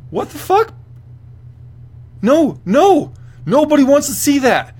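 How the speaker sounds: high, loud incredulous outburst; outraged. Real, not acted. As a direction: shriek your disbelief. Outraged reaction to the interview.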